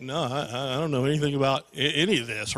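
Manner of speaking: low, soft, stammering denial; feigned innocence. Retold or imitated. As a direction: play dumb in a low, sleepy-soft voice.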